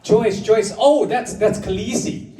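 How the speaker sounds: high, lightly voiced stranger recognizing her; a hint of a chirpy stranger pointing her out; mock afterlife stranger. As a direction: pitch it up like a helpful bystander. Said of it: "And they'll say".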